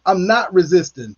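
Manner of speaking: ordinary delivery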